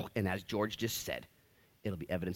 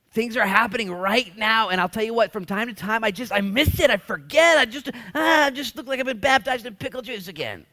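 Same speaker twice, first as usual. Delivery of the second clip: emotional outburst; 9 semitones higher, 11 dB louder than usual. Real, not acted. Pastor overcome by emotion.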